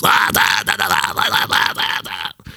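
imitating a ranting speech as babble; mock his ranting speech with nonsense syllables; mock ranting gibberish. Preacher mocking Zelensky's speeches, babbling gibberish. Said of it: "all you can hear is".

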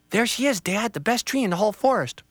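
put-on an eager child pointing out a tree; lightly voiced child. Child tells Dad about the tree, lightly voiced kid.

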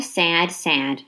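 usual speaking voice

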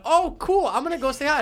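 very high, mock-cheery voice; fake cheerfulness. Visitor covering his real thoughts with mom, fake cheery. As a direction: put on an exaggerated high, innocent chirp. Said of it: "In my head, I'm going".

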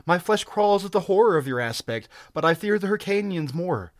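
high-pitched, urgent woman's voice; fearful pleading. A narrator's reading. Captive begging to go with barbarian, frightened. Terrified captive begs the stranger.

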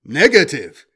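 loud, cold machine refusal; robot voice. A narrator's reading. Robot rejecting the trader's demand, loud flat refusal. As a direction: state it like a war machine.

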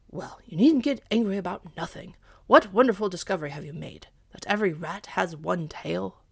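loud, fast gruff scoff; scoffing dog. Acted. Dog scoffs at the cat's discovery, loud and fast.

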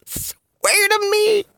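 very high, drawn-out strangled wheeze; Batman. Acted. Laughing at own Batman joke, strained impression. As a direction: squeeze it out slowly while cracking up.

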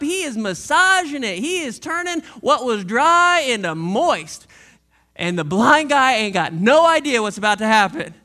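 ordinary delivery

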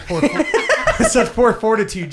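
cracking up mid-sentence, squealing high laugh; fighting through giggles. Real, not acted. Joking about his nipples to friends, helpless laughter. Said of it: "can't get it out".

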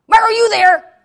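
high, loud yell down the phone; sudden shrieked demand, hammed up. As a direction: imitate an irate caller yelling. Sister demanding attention on phone.

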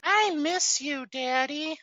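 put-on sappy child parody; mock kid voice. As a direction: drag it out like a whiny little kid. Mocking the dubbed kid line.

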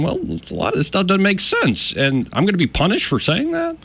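high-pitched imitation of the scientist's questioning; Matthew Wailicki.

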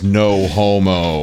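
slow, drawn-out no homo; drawn-out hero one-liner as laser fires; slow power line. Acted. Jerry blasts the car, slow drawn-out line. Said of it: "points his hand like this towards the car and goes".

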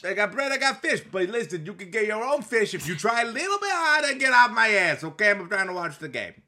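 high, loud Jersey preacher; New Jersey pastor. Acted. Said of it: "pastor from New Jersey".

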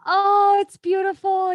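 delighted. Real, not acted. First sight of the tattoo.

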